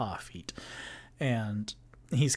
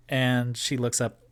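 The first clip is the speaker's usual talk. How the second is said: ordinary delivery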